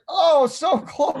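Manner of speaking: loud, high, drawn-out groan of excitement; excited shout. Real, not acted. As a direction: call out the near miss. Near miss in live match.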